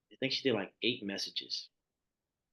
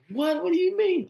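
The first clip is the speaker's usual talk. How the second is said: baffled surprise; 8 dB louder than usual. Retold or imitated. Told she cannot write downloads.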